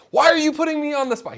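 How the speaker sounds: high, loud mock protest; mock indignant professor. Professor protesting to the speaker after the talk, mock indignant and high. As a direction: playfully accuse the questioner of ambushing him. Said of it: "he came up shaking his fist".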